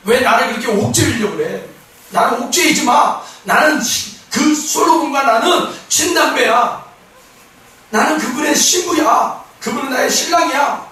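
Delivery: bold protest. Acted. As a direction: stand up to your accusers. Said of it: "당당하게 얘기하고 있는 거예요".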